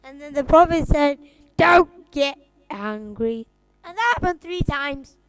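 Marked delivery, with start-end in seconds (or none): 1.6-3.5 loud, slow and emphatic; slow, forceful quoted command; emphatic quote. Acted. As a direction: lay down the rule word by word, loudly. Pupil acts out the Prophet's words.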